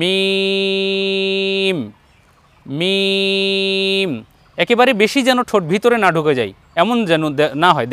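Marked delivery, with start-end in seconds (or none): 0.0-4.1 মিম held long with the dry outer lips; slow, drawn-out মিম; lips on dry part. Shown as a demonstration. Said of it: "শুকনো স্থানে লাগিয়ে উচ্চারণ করতে হয়".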